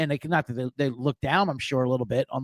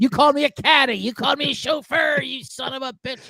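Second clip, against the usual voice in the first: higher, hammed-up indignant shout; mock coach rant; 10 semitones higher than usual. Host imitating an insulted interim coach, higher mock shout. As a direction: play a coach yelling about a made-up insult. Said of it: "Like we said".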